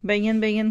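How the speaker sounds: बैयन बैयन misread in two syllables each; mistaken reading. Shown as a demonstration. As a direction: say बैयन बैयन as "bai-yan bai-yan", splitting the vowel. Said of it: "पढ़ने में कई गलतियां करेंगे".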